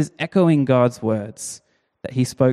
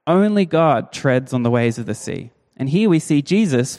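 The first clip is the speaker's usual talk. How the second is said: ordinary talk, nothing marked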